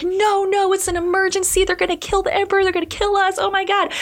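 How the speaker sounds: high, frantic pleading at the gate; panicked eunuchs. Retold or imitated. Eunuchs begging the guards to let them in, high and panicked. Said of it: "But the eunuchs are like".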